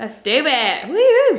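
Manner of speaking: loud whoop, leaping high at the end; excited cheer. Real, not acted. Ghost hunter hyping up the next experiment, excited cheer.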